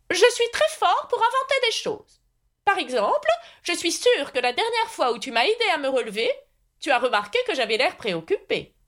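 high-pitched, self-satisfied knight; eccentric knight, proud inventor. A narrator's reading. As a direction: boast earnestly in a high character voice. (chevalier inventeur fier de lui, voix haut perchée)

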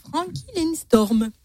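slow, high, self-mocking English accent on the name. Shown as a demonstration. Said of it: "Toujours avec mon super accent anglish".

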